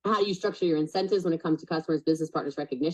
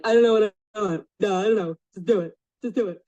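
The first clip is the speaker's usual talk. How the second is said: higher, louder, careless voice; mock clueless client; 5 semitones higher, 5 dB louder than usual. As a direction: act like a client who knows nothing. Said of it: "I was like".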